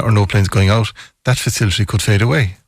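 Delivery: normal talking voice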